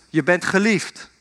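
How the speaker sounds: mild call, no real shout; father calling out. Retold or imitated. Father calls into the mountains for his son, mild calling out. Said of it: "En zijn vader roept".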